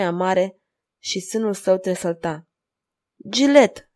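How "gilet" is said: high, loud pleading call; imploring. A narrator's reading. Anguished father imploring his silent daughter, pleading. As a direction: call out her name, begging. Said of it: "imploră Tribule zdrobit de această durere mută".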